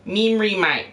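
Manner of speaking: high, hammed-up Australian accent. Streamer showing how an Australian would say it, hammed-up Australian accent. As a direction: put on a high Aussie voice. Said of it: "That's how an Australian would say it".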